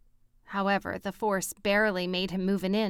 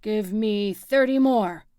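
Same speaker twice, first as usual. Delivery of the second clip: out of breath mid push-up; louder, winded gasp of effort; puffing; 7 dB louder than usual. A narrator's reading. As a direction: puff it out between reps. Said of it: "Harry puffed".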